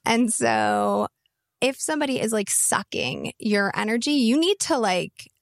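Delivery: put-on creaky valley-girl fry voice; vocal fry. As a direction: let the words sink into a creaky fry. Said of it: "like, vocal fry for this".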